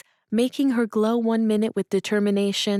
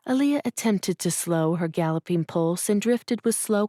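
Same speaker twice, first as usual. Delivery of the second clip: ordinary delivery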